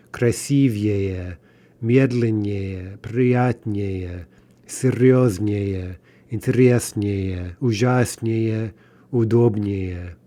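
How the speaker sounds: slow "красивее", "медленнее", "удобнее", each stressed on the stem, unstressed -ее; stress stays on the stem. Shown as a demonstration. Teacher models regular long comparatives for learners, slowly stressing stems as in "ужаснее", "интереснее". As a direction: draw out each word, hitting си in "красивее" and до in "удобнее". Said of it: "ending would not be stressed".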